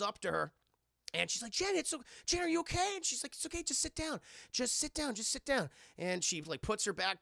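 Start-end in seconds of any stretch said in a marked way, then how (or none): 1.5-5.7 put-on high, gentle girl voice; imitating a worried girl calming someone; concerned sister. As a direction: soothe in a high voice. Sister soothes Janet.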